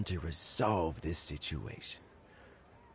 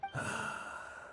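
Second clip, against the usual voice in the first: long breathy unvoiced sigh; weary sigh; 8 dB quieter than usual. Betrayed old man sighing over a lost friend, soft sigh. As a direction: let out a heavy, tired sigh.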